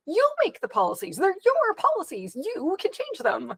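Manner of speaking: arguing back with university staff, high and exasperated; exasperated retort. Retold or imitated. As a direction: spell it out in high-pitched disbelief. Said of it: "I'm like".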